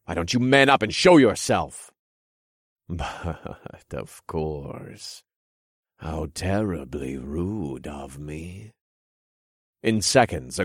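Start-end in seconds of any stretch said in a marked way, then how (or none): 0.0-1.9 defiant shout. Acted. Hero challenges the hidden king. 2.9-8.7 slow, soft, mocking villain voice; sly villain king.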